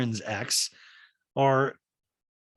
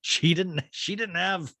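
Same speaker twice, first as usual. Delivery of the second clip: higher, hammed-up pompous dude; mock male voice; 6 semitones higher than usual. Host mocking the male view of a pregnancy scare, hammed-up. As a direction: lampoon a smug guy talking down about a woman. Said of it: "a very male thing to me".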